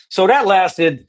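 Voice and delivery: usual speaking voice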